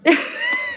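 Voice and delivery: high-pitched squeal of laughter; cracking up. Real, not acted. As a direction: burst out laughing at your own joke. Cracks up at own imagined yell.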